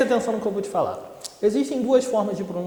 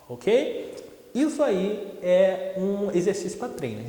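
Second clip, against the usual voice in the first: usual speaking voice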